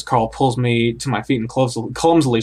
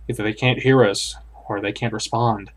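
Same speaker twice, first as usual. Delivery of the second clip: plain reading voice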